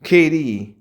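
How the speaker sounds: normal talking voice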